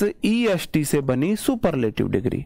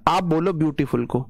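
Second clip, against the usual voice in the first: normal talking voice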